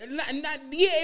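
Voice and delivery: ordinary delivery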